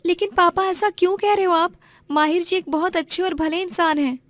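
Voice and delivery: a modest village girl, voiced high and gentle; young woman's voice. Narrator voices Aarohi.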